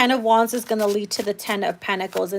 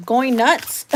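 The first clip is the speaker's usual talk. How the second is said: ordinary delivery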